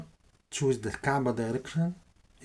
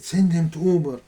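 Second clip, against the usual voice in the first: loud, high retold order; retold command; 5 semitones higher, 9 dB louder than usual. Tyrant orders the prisoner sent off, retold command. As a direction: call the order out to the guards.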